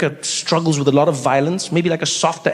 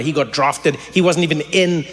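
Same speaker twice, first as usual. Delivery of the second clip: ordinary delivery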